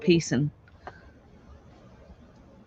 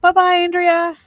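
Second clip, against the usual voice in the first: calling out goodbye; 12 semitones higher, 6 dB louder than usual. Real, not acted. Calling goodbye across room.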